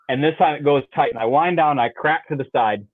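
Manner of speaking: normal talking voice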